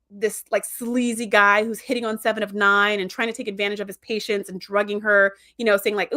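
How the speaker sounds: usual speaking voice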